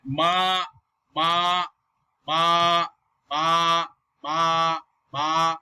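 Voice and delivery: "ma" held long, starting mid and lifting; drawn-out "ma" as a second tone; rising second tone. Shown as a demonstration. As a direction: slide ma upward from the middle. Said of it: "rising tone is also called the second tone".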